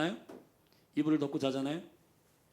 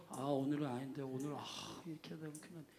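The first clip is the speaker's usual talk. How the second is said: much softer, drowsy mumble; sleepy groaning reluctance; 12 dB quieter than usual. Pretend. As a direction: sound like you can't get up. (이불 속에서 일어나기 싫은 졸린 중얼거림)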